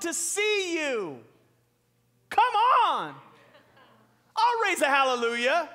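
high, loud hallelujah cry; preaching shout. Real, not acted. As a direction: lift the church with a full-throated shout.

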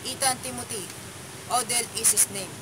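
"Ethan Timothy", pause, then "Odell is his name"; pause after Timothy. Shown as a demonstration. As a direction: break the phrase right after "Timothy". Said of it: "Let's talk about phrasing".

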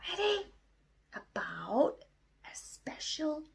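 lively storytime voice for the class; reading to children. Acted. Teacher reading a story to her class, lively. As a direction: read it out brightly to the kids.

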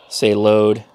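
ordinary talk, nothing marked

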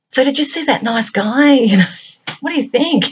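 put-on high, giggly teen-girl chatter; gushing young girl. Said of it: "the girls talk like that".

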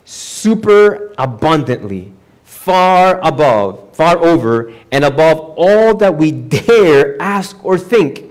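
"super abundantly, far above" drawn out slowly and emphatically; stressed superlatives. Shown as a demonstration. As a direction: stretch "far" and hit "dare" hard. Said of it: "how they translated the superlatives".

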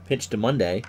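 baseball announcer calling the action; play-by-play. Acted. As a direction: call each pitch like live radio. Live play-by-play of baseball game.

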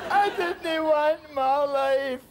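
high, slow, loud and tearful; crying out. Acted. Rescued man cries that he never wanted his life. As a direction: cry it out in despair.

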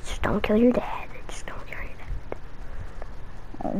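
soft unvoiced whisper; whispered warning.

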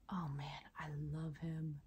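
soft awed whisper. Real, not acted. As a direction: hush it like a secret. Admiring the crystal.

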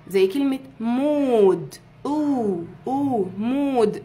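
مود drawn out very slowly with a long oo. Shown as a demonstration. Teacher slowly modelling مود for the class. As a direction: stretch مود as mood, slowly.

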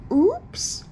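mock oops. Feigned accident.

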